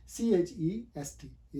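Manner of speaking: letters spelled out very slowly; slow spelling. Shown as a demonstration. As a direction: spell each letter apart, unhurried. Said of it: "spells the word slowly".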